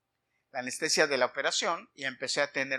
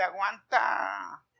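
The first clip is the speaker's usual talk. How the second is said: very slow, drawn-out "aguanta"; long-dragged imitated retort; mocking retort; 3 semitones higher, much slower than usual. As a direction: stretch "aguanta" out as a mock comeback. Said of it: "me dieron ganas de decirle".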